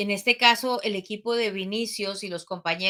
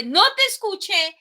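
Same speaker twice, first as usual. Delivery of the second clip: higher, louder mock call; teasing call; 9 semitones higher, 3 dB louder than usual. Instructor teasing the jumper to shout louder, higher. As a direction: call it out as if you can't hear. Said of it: "cuando dice".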